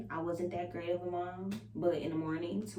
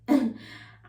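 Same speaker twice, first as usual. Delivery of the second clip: burst of laughter; 6 semitones higher, 10 dB louder than usual. Real, not acted. Laughing at own boast.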